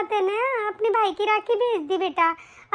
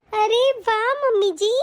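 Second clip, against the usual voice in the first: ordinary talk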